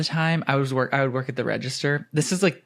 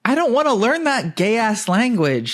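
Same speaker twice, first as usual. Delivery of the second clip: high, exaggerated refusal; mock protest; 9 semitones higher, 3 dB louder than usual. Worker refuses customer's French lesson, high mock protest. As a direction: push it up high in comic exasperation.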